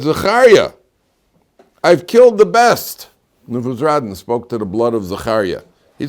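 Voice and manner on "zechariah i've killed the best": high, raised-voice exclamation; loud appeal. Acted. Nebuzaradan pleading with Zechariah's blood, loud appeal. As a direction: cry out to someone who won't relent.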